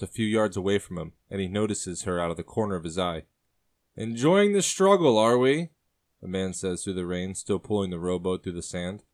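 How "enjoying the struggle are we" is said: man's voice, higher and louder, teasing; teasing sailor. Acted. Sailor teasing a woman watching him struggle, loud and sly. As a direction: needle her with a sly man's tease. Man teases Ariana on the beach.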